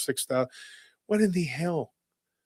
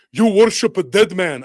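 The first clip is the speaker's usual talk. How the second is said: loud, higher imitated accusation; mock accusation; 6 semitones higher, 7 dB louder than usual. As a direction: hurl it like an indignant opponent. Retold accusation in an argument.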